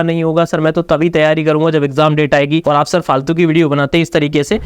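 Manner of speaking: ordinary talk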